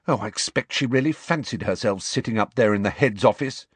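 usual speaking voice

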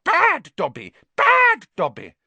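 high, loud elf self-scolding; shouting. A narrator's reading. House elf banging his head in punishment, shouting. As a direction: yell it twice, punching the first word.

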